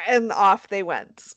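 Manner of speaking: ordinary delivery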